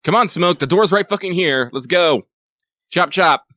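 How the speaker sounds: loud, urgent hurry-up call. Real, not acted. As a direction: chivvy someone out the door. Calling out to someone to hurry.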